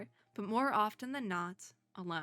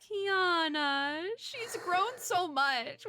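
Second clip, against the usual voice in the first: high, slow and tender; tender fond voice; 8 semitones higher, much slower than usual. Real, not acted. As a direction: coo it like a proud parent. Tender narration-like cheer for character.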